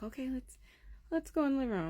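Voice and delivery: high, sing-song put-on ease; feigned unconcern. Mother steers daughter to living room, feigning calm. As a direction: keep it light to hide the alarm. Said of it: "I didn't wanna seem alarmed".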